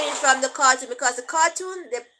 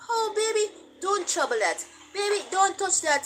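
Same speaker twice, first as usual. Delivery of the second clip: higher, gentle cooing warning; sweet English baby talk; 6 semitones higher than usual. Retold or imitated. Parent gently warning a child in English, higher and cooing. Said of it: "your child look upon you and must say".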